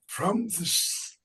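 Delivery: slow, high, softened documentary voice; hushed, slow parody of nature narration; nature documentary narrator. Acted. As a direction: narrate a nature film, drawing out words. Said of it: "National Geographic story".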